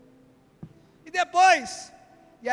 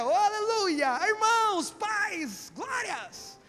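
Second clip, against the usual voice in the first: high, sing-song holy greeting, fading out; mock saintly church voice, high and sweet; churchy pious voice; 8 semitones higher, much slower than usual. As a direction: ham up the overly pious believer. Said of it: "Na igreja é".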